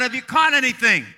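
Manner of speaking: high, loud call across the water; retold yell. Jesus calling to the fishermen out on the sea, yelling. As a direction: call out loudly to men far off. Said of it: "he yells out".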